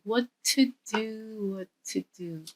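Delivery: soft, musing singled-out words; scheming to himself. Acted. Boy looks around brothers' room, quiet scheming. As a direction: ponder mischief under your breath.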